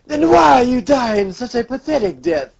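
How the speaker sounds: hammed-up villain question; Doflamingo. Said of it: "He's like".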